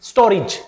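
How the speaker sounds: स्टोरेज said as STO-rij; correct pronunciation. Shown as a demonstration. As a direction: say स्टोरेज with "ri" instead of "re". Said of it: "ये रे बन जाएगा री". (स्टोरेज का सही उच्चारण, "स्टोरिज")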